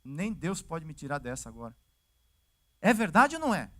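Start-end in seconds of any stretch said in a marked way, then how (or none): 0.0-1.8 low and soft, giving up; a touch of defeated resignation; resigned despair. Retold or imitated. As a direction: sigh it out as a hopeless man. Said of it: "Ezequias podia falar".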